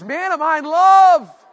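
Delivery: loud, high, drawn-out outburst; exuberantly in love. Acted. Preacher acting out what being ravished means, exuberant. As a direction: belt it out, completely smitten. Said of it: "It means".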